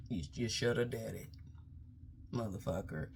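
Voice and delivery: normal talking voice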